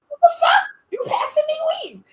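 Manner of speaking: hammed-up high-pitched what the fuck; outraged disbelief. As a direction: shriek it in disbelief. Her retort, repeated.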